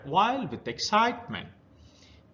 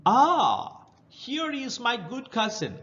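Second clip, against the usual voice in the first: pleasant crab; 7 semitones higher than usual. Acted. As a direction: greet sweetly like a friendly crab. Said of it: "said the crab pleasantly".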